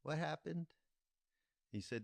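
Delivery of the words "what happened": high, loud, alarmed concern; a teacher's anxious cry over a hurt student; upset guru. Retold or imitated. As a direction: ask in sudden worried alarm, pitched high. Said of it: "with a very upset look".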